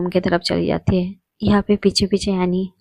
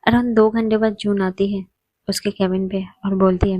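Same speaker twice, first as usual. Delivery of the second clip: ordinary delivery, nothing marked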